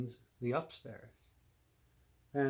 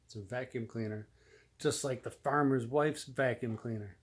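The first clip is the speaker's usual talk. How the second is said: ordinary delivery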